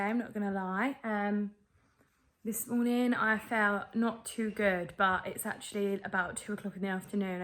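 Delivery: usual speaking voice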